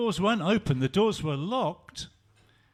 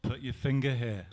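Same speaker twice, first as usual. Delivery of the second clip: gentle; 6 semitones lower than usual. Acted. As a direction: gently invite him closer. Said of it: "Jesus said very gently".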